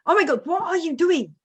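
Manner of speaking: high, exasperated cry at someone off screen; exasperated call. Real, not acted. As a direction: call out in disbelief. Calling out at husband.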